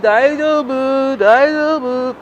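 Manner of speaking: slow, high, soothing daijobu; calm them down gently, slowly; reassuring. Retold or imitated. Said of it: "I'm like".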